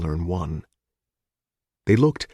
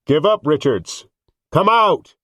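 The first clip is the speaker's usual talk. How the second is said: loud shout; 8 semitones higher, 4 dB louder than usual. Acted. Crowd shouting.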